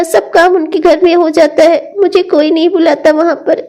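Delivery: normal talking voice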